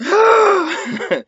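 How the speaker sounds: sudden high yelp of pain after a gasp; gasped high cry of shock; stabbed yelp. Retold or imitated. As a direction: yelp as if a blade just went through your hand. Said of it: "This motherfucker goes".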